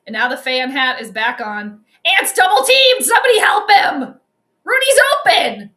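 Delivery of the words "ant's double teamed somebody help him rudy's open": loud and high, urgent shouting at the screen; frantic fan calling for help for Ant; excited fan yelling. Real, not acted. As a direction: yell like the game depends on it. Fan yelling at live game.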